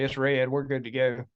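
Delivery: usual speaking voice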